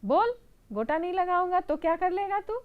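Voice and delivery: high, loud and fast taunt; mocking challenge. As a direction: dare the mouse. Lace seller challenges mouse. (ऊँची, तेज़ ललकार)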